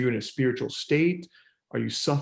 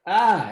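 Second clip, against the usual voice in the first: startled defensive ah; 6 semitones higher, 6 dB louder than usual. Retold or imitated. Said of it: "they put up their shield".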